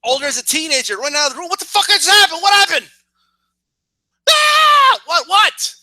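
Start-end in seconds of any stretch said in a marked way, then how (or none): 1.5-2.9 high, loud alarmed questions; panicked shout. Retold or imitated. Teen running in from another room, loud and high panic. 4.3-5.8 piercing high shriek into frantic what; scream, then demand what happened; startled scream. Retold or imitated. Teen hearing a scream at home, high loud shriek.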